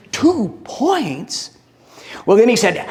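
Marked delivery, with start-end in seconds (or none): 0.1-1.5 a higher, stunned echo of the score; mock dismay at the tally; incredulous. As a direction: pitch jumps up in disbelief. Man hears his score again.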